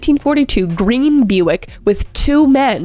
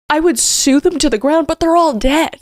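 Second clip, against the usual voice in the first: upset outburst; 7 semitones higher than usual. Real, not acted. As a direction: voice climbing high with indignation. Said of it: "I'm getting so emotional about this".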